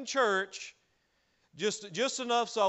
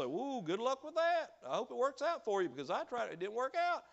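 softer, sing-song sarcastic "Ooh, good luck"; mock doubter; 5 semitones higher, 5 dB quieter than usual. Preacher mimicking scoffers who doubt your efforts, softer sarcastic. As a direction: play the smug naysayer with a light sneer. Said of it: "They'll say".

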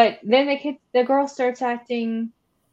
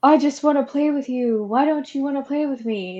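higher, louder child voice, imitating; creepy little girl; 6 semitones higher, 5 dB louder than usual. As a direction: plead like a needy kid wanting a playmate. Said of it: "is like".

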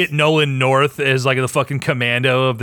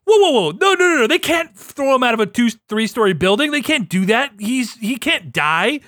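panicked gamer, pitched up; imitating shocked gamer; 7 semitones higher than usual. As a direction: protest in disbelief as he falls. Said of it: "you're like".

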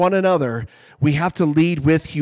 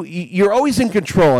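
ordinary delivery

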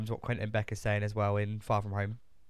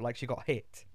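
ordinary talk

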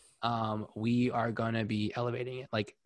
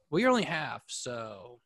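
ordinary talk

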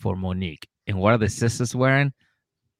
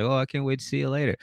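imitated girlfriend; 5 semitones higher, 5 dB quieter, faster than usual. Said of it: "She's like".

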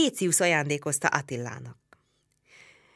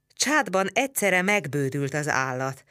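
usual speaking voice

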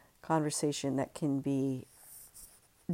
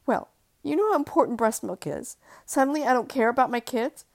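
louder, bitter and defensive; 8 semitones higher, 7 dB louder than usual. Acted. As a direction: snap back bitterly. Wife defensive.

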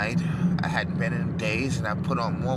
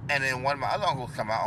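ordinary talk, nothing marked

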